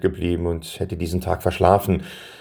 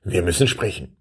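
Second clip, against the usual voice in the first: mysterious. A narrator's reading. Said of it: "sagte er schließlich geheimnisvoll".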